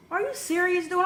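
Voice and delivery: high-pitched disbelieving retort; incredulous. Retold or imitated. Robbed woman confronting her neighbor, incredulous.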